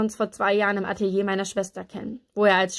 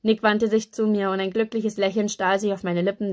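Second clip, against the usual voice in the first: ordinary delivery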